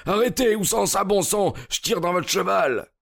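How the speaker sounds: high-pitched, threatening yell; yelled threat. A narrator's reading. Cyclist drawing a pistol on the cart, yelled threat. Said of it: "hurla-t-il en tirant de sa poche un pistolet".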